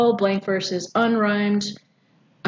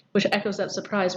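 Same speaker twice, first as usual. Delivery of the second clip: ordinary talk, nothing marked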